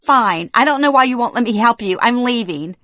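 fed-up daughter giving up; exasperated retort. A narrator's reading. Daughter snaps back in a quarrel.

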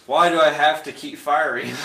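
loud, mock complaint; lightly voiced loud grumble at endless firing; exasperated character. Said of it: "and then he says".

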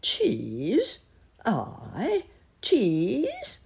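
astonished. A narrator's reading. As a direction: ask in rising disbelief, word by word. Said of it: "asked astonished Uncle Wiggily".

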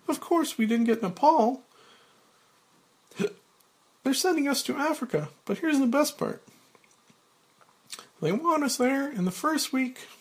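put-on very high, eager young woman's voice; young Claire. Young Claire tells Frank their posting, high eager voice. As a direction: pitch it way up, sharing news.

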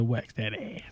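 hushed whisper. Retold or imitated. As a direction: whisper it under your breath. Quoting a crude joke.

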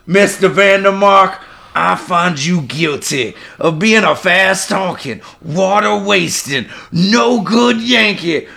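put-on a bayou judge scolding a Yankee, thick accent; thick Cajun accent. Judge scolding the defendant, thick Cajun accent. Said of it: "the judge are both hard accents".

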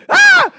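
piercing, very high imitated shriek; hammed-up scream of fright; startled mom scream. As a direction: scream loudly as a mother caught off guard. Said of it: "And she's like".

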